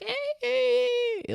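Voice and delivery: very high-pitched squeak; tiny squeaky high squeal. Shown as a demonstration. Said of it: "like, squeaky pitched, 'cause that could get annoying".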